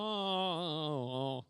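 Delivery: a yawn through the word. Real, not acted. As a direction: yawn as you say it. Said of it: "Sorry, I'm yawning up here".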